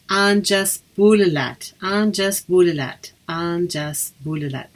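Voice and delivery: slow, careful "Anjas Bulelat"; teacher repeating "Anjas Bulelat" for the class; Irish greeting demo. Shown as a demonstration. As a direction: say "Anjas Bulelat" slowly, stress on "Anjas". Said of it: "You might then say to them".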